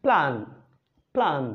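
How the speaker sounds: "প্ল্যান" said with a short a; clipped, clear "প্ল্যান"; short vowel sound. Shown as a demonstration. Teacher drills the short a in "প্ল্যান". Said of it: "এটা short sound হলো".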